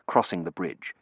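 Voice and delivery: usual speaking voice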